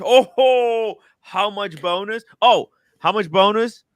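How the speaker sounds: high, whooping delight; impressed, loud whoop into questions; excited curiosity. Real, not acted. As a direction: pounce on the news with a high, eager question. Reacting to viewer's bonus message.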